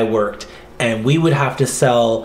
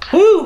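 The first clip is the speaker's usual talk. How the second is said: whoop; 6 dB louder than usual. Real, not acted. Burst after the joke.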